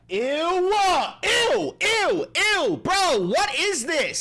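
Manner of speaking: high loud disgusted ew; disgusted shriek. Real, not acted. Disgusted reaction.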